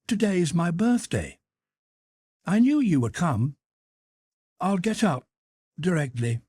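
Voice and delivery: put-on high boyish voice, just waking; a small son, pleased and drowsy; little boy's voice. A narrator's reading. Son waking to his mother, high boyish voice.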